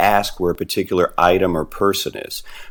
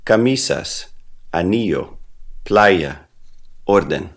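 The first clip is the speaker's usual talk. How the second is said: ca-MI-sas, a-NI-llo, PLA-ya, OR-den, one at a time; teacher's word list, "Camisas, anillo, playa, orden" each stressed next to last; next-to-last syllable stress. Shown as a demonstration. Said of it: "stress the next to last syllable".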